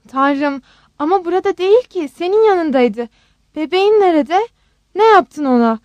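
mildly sobbing panic, pitched high; frantic mother demanding answers, a touch tearful; panicked sobbing. A narrator's reading. Rose demands to know where her baby is, panicked. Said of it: "diye hıçkırmaya başladı Rose".

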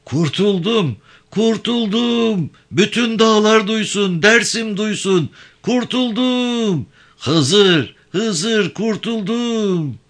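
very high, drawn-out cries of deliverance; exultant high-pitched yelling; jubilant shouting. A narrator's reading. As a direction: shout to all the mountains, stretching the word ends. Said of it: "bağırmaya başladı".

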